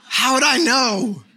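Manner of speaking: high, loud, drawn-out comic protest; incredulous protest. Retold or imitated. Father answering wife about the child's cry, mock incredulous. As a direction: protest helplessly, slow and loud.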